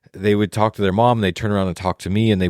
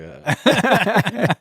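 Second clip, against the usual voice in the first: awkward caught-out uh; 13 semitones higher than usual. Retold or imitated. Caught out by the cowboy.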